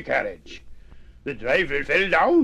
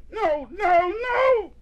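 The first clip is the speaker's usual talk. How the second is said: very high, louder wail, acted; distressed cry; 4 dB louder, slower than usual. A narrator's reading. Young mouse weeping at the book, high acted cry. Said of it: "cried Nubbet, weeping large-sized tears".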